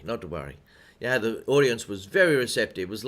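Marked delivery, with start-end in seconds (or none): 0.0-0.6 repeated softer and lower, to himself. Shown as a demonstration. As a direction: mutter it again under your breath, easing off. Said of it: "Uh, not to worry".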